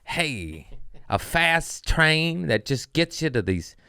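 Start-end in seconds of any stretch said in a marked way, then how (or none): none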